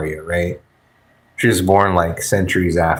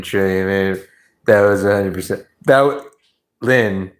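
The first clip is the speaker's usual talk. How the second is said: ordinary talk, nothing marked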